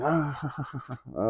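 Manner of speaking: soft, mock genie laugh; imitated genie laugh. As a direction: laugh like a genie, kept quiet. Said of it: "like, laughing".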